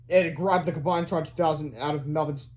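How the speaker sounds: usual speaking voice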